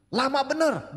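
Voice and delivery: exasperated parent. Retold or imitated. Impatient parent quoted.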